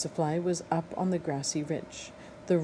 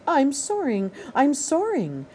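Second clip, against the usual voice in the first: high, loud, thrilled gosling; overjoyed little bird; joyful cry; 8 semitones higher, 4 dB louder than usual. Acted. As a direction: shout it with delight as he rises.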